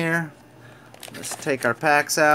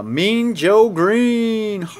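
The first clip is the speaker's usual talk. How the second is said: drawn-out, higher and slow reveal; excited announcement; 7 semitones higher, 3 dB louder, much slower than usual. Real, not acted. Opening a pack on camera, he announces the card in a slow drawn-out voice. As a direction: stretch out the name like a big reveal.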